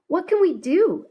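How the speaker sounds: high, worried little question; a touch of fear. A narrator's reading. A hint of worry. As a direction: sister asking her brother in the dark, a bit scared. Scared girl in the dark.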